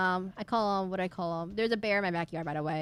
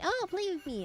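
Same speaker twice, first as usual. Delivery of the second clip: playful dog voice; 10 semitones higher than usual. Pretend. Voicing friend's dog.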